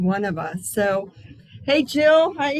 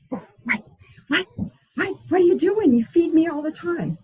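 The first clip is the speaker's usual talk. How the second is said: indignant squirrel; 5 semitones higher, faster than usual. Retold or imitated. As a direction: play the hungry squirrel demanding its snack. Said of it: "He's like".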